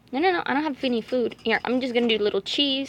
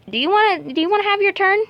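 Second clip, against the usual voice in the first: much higher, louder cooing; 9 semitones higher, 6 dB louder, faster than usual. Real, not acted. Offering the bunny a turn, high cooing baby talk. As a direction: coo at the bunny sweetly.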